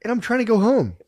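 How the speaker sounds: high, loud plaintive protest; mock exasperation. Co-host playing the stranded passenger at the counter, mock exasperation.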